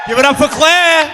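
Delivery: rally the crowd for her; hype shout. Real, not acted. Host calling for applause for Claire, shouting.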